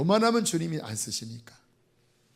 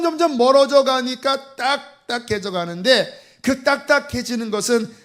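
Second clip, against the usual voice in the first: higher and louder, preaching with force; fervent preaching; 5 semitones higher, 5 dB louder than usual. Real, not acted. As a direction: drive the warning home to the congregation.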